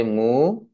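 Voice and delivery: "মু" stretched long with rising pitch; drawn-out syllable. Shown as a demonstration. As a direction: hold the vowel of "মু" and lift it. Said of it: "যেখানে যেখানে আমার vowel থাকে সেখানে এক অক্ষর".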